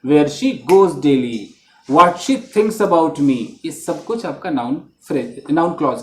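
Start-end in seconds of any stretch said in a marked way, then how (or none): 0.1-3.5 slow examples. Shown as a demonstration. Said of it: "नहीं तो पूरा का पूरा क्लॉज होगा".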